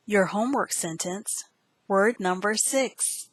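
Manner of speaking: ordinary delivery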